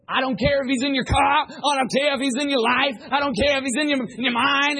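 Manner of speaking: high, loud rapid-building revival rant; preacher going crazy. Retold or imitated. Imitating the preacher going off at the congregation, frenzied high shout. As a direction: rant at full volume like a fireball preacher. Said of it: "he's just going crazy".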